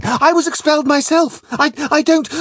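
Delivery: high, panicky, stammering excuse; quaking cry. A narrator's reading. Flashman pleading his own expulsion to the captain, quaking. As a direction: blurt it out in fright to placate him. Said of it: "cries I, quaking".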